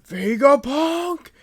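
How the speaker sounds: loud, high drawn-out yell; big acted shout; calling out. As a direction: holler the name out, stretching it. Acting out a character's outcry.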